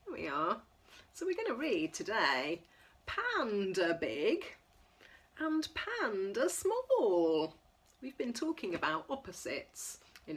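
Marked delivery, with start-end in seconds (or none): none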